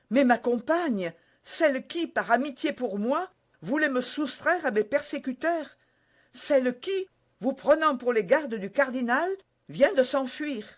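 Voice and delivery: lightly voiced young wife, earnest; young woman's voice. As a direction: naive Constance, a touch eager. Constance voiced by narrator.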